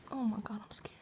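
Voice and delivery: scared whisper. Real, not acted. Alone in her room at night, frightened whisper. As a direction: whisper it so nothing hears you.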